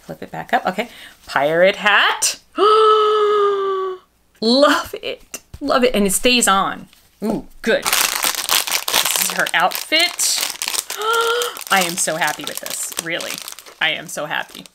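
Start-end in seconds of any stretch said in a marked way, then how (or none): 2.6-4.0 sharp high gasp of delight. Real, not acted. Sees doll outfit. 10.5-11.6 delighted gasp. Real, not acted. Sees doll outfit.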